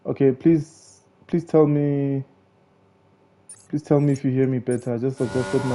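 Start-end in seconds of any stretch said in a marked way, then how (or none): none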